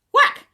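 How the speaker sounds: loud comic whack; whack sound. Acted. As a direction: punch the whack. Tail hits sisters.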